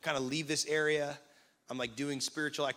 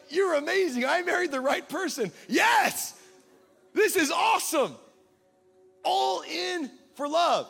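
much higher and louder, shouting with joy; excited shout; 12 semitones higher, 7 dB louder, slower than usual. Retold or imitated. Pastor retelling his joyful answer to his wife, shouting. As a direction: cheer it out like a husband overjoyed.

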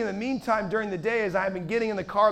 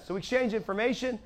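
ordinary talk

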